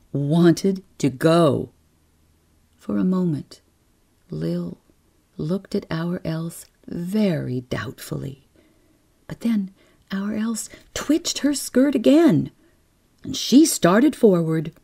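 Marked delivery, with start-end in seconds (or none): none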